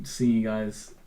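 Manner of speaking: ordinary talk, nothing marked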